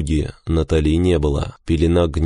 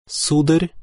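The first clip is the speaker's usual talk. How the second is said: calm, higher-pitched woman's voice; calm wife; 11 semitones higher than usual. A narrator's reading. Wife to husband as to a stranger, calm. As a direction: address him coolly as if a stranger, pitched high. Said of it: "спокойно произнесла она".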